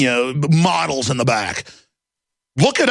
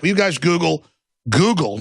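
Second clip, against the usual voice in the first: usual speaking voice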